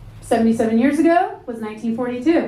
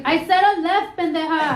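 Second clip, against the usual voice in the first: put-on Mexican accent, scolding, a bit higher; 4 semitones higher than usual. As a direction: scold like an impatient Mexican mom.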